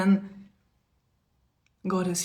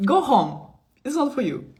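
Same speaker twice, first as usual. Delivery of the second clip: dismissive brush-off; 5 semitones higher, 4 dB louder than usual. Real, not acted. Dismissing a chat commenter, laughing.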